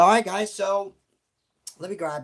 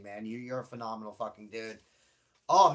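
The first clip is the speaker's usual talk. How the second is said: ordinary talk, nothing marked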